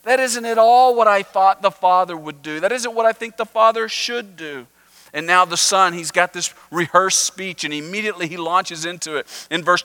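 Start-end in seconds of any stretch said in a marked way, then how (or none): 0.0-4.7 indignant moaning. Retold or imitated. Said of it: "the Pharisees are moaning".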